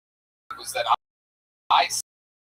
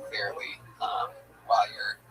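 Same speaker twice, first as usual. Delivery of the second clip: much softer, under the breath; muttering aside; 6 semitones lower, 9 dB quieter than usual. Real, not acted. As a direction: murmur it off to the side.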